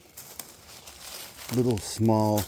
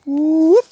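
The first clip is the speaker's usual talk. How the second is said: loud, high whooping call; 13 dB louder than usual. Real, not acted. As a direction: whoop out to the woods.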